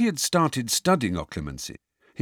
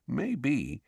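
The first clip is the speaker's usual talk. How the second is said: hushed, conspiratorial; lowered voice; 4 semitones higher, 7 dB quieter than usual. A narrator's reading. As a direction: drop your voice to share a secret. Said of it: "in a lower voice".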